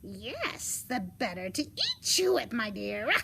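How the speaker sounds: building, loud hungry wolf; menacing wolf. Acted. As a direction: drop the disguise and pounce on the words. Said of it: "the wolf said".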